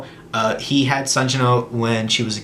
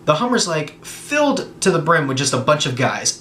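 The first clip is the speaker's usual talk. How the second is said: ordinary delivery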